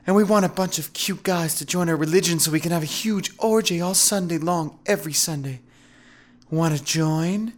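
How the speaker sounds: high, flirty feminine voice; flirty recruiting pitch, lingering on the ends; seductive woman recruiter. A narrator's reading. Veronica recruits him for sex church.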